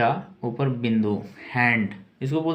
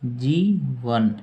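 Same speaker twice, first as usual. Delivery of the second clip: जीवन stretched slowly, each syllable held; very slow teacher's dictation; much slower than usual. Shown as a demonstration. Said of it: "life का अर्थ है जीवन".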